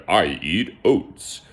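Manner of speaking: slow, lightly voiced horse; a hint of a horse character; storybook horse. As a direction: answer slowly as the horse.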